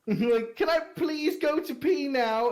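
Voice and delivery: put-on high-pitched pleading little boy; whiny child. Son begging his father to let him pee, whining high. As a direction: whine like a kid desperate for the toilet. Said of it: "he goes".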